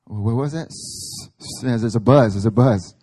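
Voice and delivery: loud, mock-puzzled listener; mock static complaint. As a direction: pretend the line is buzzing. Mock believer hearing static.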